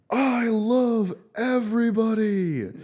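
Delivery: high, drawn-out mock yell; drunken gushing holler. Drunk student running into an alley, hollering lovingly. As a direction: holler it to the whole alleyway, stretched out. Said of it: "running out into an alleyway and being like".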